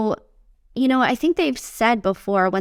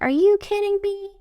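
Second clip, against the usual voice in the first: high-pitched, incredulous outburst at other drivers; exasperated road rage; 11 semitones higher than usual. Retold or imitated. Driver stuck in traffic, fed up with other cars: high exasperated outburst. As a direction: snap it out like you're stuck in traffic. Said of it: "and then I'm like".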